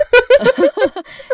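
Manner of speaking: big high burst of laughter; loud laugh. Real, not acted.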